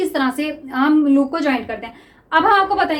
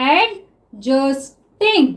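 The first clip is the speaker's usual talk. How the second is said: stretched very slowly while writing; drawn-out word; much slower than usual. Shown as a demonstration. Teacher writing shorthand for students, word stretched slowly. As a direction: draw the word out as you write it. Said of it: "teacher sounds the word out while writing".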